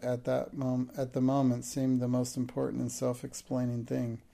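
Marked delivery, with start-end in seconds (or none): none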